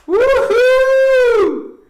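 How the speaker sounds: high, loud, long whoop that falls away; excited whoop. Real, not acted. Streamer cheering a viewer's comment with a loud whoop. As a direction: whoop with joy for her.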